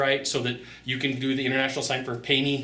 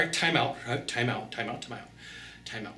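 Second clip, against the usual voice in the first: each time out softer until almost nothing; calming a heated salesman, getting softer each time; fading time out; 3 semitones lower, 7 dB quieter than usual. Retold or imitated. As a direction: let the time outs die away to a hush. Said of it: "Let's, let's just calm down".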